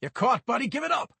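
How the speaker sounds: high, loud order while wrestling; forceful shout. Acted. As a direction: shout him into giving up. Cop pinning the kid down.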